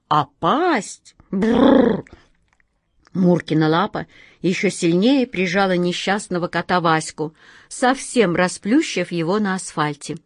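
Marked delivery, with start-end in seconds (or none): none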